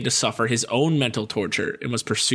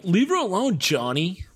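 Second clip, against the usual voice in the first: higher, earnest nerd voice; nerd standing up; 6 semitones higher than usual. Retold or imitated. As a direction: stand up to the bully. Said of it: "the nerd's like".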